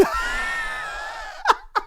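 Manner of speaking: high, helpless laughing fit; real laughter. Real, not acted. Host cracking up at his punchline, real helpless laughter. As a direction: crack up at your own joke.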